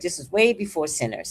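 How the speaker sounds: normal talking voice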